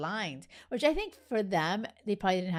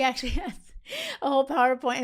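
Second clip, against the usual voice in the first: laughing; 5 semitones higher, 5 dB louder, much faster than usual. Real, not acted. Laughing at the PowerPoint.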